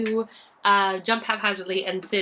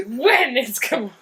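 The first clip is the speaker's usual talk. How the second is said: laughing high and loud; 6 semitones higher, 8 dB louder than usual. Real, not acted. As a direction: laugh through the words. Laughing joke with the others.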